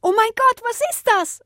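sehr hohe, laute Angststimme; erschrockener Schrei. A narrator's reading. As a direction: schrei entsetzt auf. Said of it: "schrie Henny". (Henny schreit entsetzt auf)

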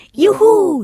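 loud, high whoop of joy; cheering. A narrator's reading. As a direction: cheer out in a soaring voice. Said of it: "jubelten beide".

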